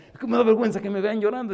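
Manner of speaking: voz aguda y fuerte de quien llora; feligrés lloroso. Retold or imitated. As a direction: imitando al que llora con vergüenza. Feligrés avergonzado que llora. (imitando al hombre avergonzado que llora, voz aguda y fuerte)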